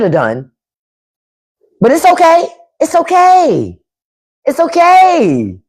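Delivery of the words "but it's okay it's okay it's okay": much higher voice, last "okay" stretched long; high-pitched self-reassurance. Real, not acted. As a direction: pitch it way up and draw out the final okay. Consoles self with mounting repeats.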